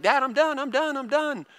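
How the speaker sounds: put-on high, eager kid voice calling out done; a young son rushing downstairs, mock eager little-boy voice; excited little boy. Dad imitating his son proudly calling out he's finished, high and eager. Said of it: "He's like".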